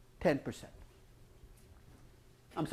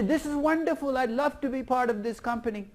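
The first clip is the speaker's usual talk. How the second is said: mock delight pitched higher; delighted risky division; 7 semitones higher than usual. Risky division thrilled with the cheap hurdle rate, mock delight. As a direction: gush like a subsidized division. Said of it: "All the risky business is gonna say".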